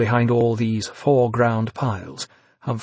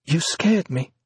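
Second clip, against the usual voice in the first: startled; 4 semitones higher than usual. A narrator's reading.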